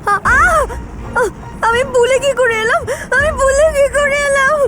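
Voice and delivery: frightened calling out. Acted.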